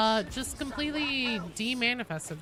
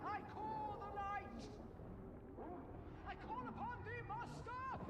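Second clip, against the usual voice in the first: very high, soft ghostly chant; creepy summoning voice; 11 semitones higher, 17 dB quieter than usual. Pretend. Player voicing a summoning ritual, eerie high. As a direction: call out like a spirit summoner.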